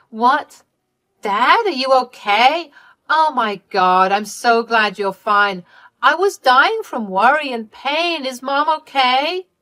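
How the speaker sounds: high, loud, drawn-out relief; frantic Candy. Acted. Daughter learning her parents are alive, frantic relief. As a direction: gush with worry, pitch swooping up.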